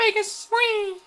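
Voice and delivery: very high, loud whoop of joy; gleeful squeal. Real, not acted. Gamer celebrating getting his cap, high gleeful squeal. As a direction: let out a squeal of delight. Cheering after the match.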